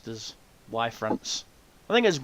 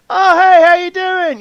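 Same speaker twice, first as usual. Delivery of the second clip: put-on loud, very high hail to an old mate; hammed-up high shouted greeting; delighted greeting; 12 dB louder than usual. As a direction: call out an exuberant hello, imitating dad. Dad greets Vader actor he knows.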